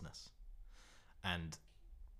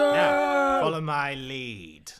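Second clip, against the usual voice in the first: high shout, then a long drawn-out order; Raxor's battle command; 10 semitones higher, 16 dB louder, much slower than usual. Acted. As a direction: bellow the command, stretching it out. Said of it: "you hear him addressing the troops".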